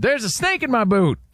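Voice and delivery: high-pitched talking toy catchphrase; pull-string toy cowboy. Retold or imitated. Host imitates a talking toy's catchphrase, high-pitched cowboy voice. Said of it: "you pulled the string and you heard".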